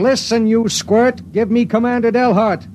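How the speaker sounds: fed-up pilot demanding the commander; impatient demand. Acted. Pilot loses patience with operator.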